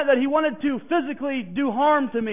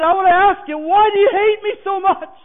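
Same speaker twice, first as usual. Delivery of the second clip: louder and higher, pleading demand; raised confrontation; 7 semitones higher, 7 dB louder than usual. Retold or imitated. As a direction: call him out, voice lifted. Confronting the man who hates him.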